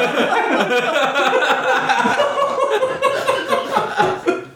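long high burst of laughter; high laughing fit. Real, not acted. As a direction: crack up helplessly. Cracking up at title.

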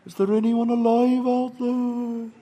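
put-on drawn-out Welsh-accented distant call; slow, sustained Welsh search call; rough Welsh accent. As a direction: call slowly across water in an amateur Welsh accent. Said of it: "my Welsh accent isn't great".

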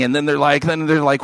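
ordinary delivery

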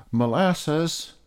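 high calling shout of a name; high shout to a friend. Acted. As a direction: call out across the garden. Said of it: "shouted Peter".

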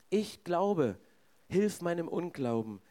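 ordinary talk

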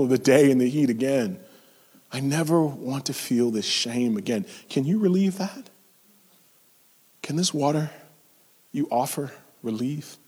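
soft, hushed, pleading confession; a low-voiced ashamed plea; pleading woman. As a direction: plead quietly out of shame.

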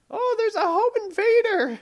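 high, loud mock panic; mock alarm. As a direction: shriek it like a startled homeowner. Acting alarmed homeowner.

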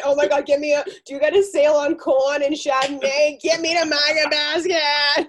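loud, high, hammed-up Boston accent; old-timey Boston accent. Friend playing a Market Basket shopper, hammed-up Boston accent. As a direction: holler it like an excited Boston shopper. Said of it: "the old timey Boston accent".

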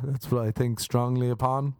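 ordinary talk

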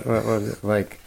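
ordinary talk

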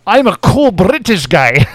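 mock Scottish-accented Bond; Connery as Bond. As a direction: play it slow and suave in a Scottish burr. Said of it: "Give me the double oh seven".

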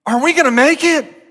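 high, mock-worried kid; child's voice. Preacher voicing his kids in a mock-worried child's voice. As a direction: play a small child asking his dad.

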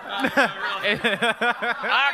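lightly voiced indignant Southern hairdresser; drawn-out put-on Southern complaint; Merle. Comic showing what he said to her, Southern hairdresser voice. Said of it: "creative character that I invented named Merle".

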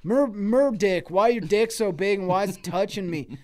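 acted complaint. Customer griping at Merdick, mock whine. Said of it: "And it's like".

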